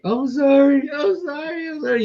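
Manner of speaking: high, frantic squealing apology; mock-hysterical high-pitched sorry; panicked apology. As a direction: beg forgiveness in a panicked squeal. Panicked apology waking from surgery.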